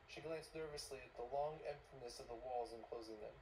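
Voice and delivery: ordinary delivery, nothing marked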